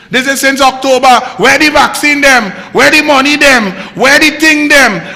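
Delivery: loud, high, heated rhetorical questions; angry rant. Real, not acted. Host berating the government over missing vaccines, loud and angry. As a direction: hammer each question at the government.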